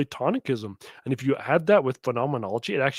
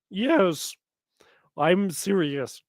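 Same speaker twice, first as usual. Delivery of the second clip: ordinary talk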